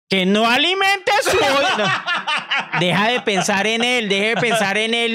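high, laughing through the rule; laughing insistence. Real, not acted. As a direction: repeat the rule while laughing. (insistiendo entre risas, voz aguda)

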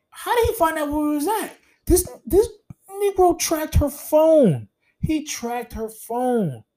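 high-pitched disbelief, stretched out; can't believe he found them; incredulous outburst. Retold or imitated. Incredulous at being tracked down.

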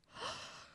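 a sharp unvoiced gasp of shock. Real, not acted. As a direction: gasp in disbelief. Shocked reaction to the confession.

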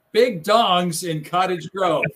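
slow, emphatic headline announcement; anchor headline read. Retold or imitated. Anchor unknowingly reading the botched script, slow proud headline. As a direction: proclaim the botched headline slowly and earnestly. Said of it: "And so she comes up".

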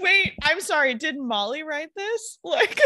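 giggly disbelief; laughing. Real, not acted. Laughing while asking her friend who wrote this. Cracking up at the story.

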